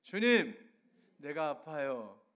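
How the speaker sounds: rising loud lament, each 주님 called out; crying out prayer. Shown as a demonstration. Preacher leading the congregation in a prayer, loud lament. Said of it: "한번 따라하겠습니다".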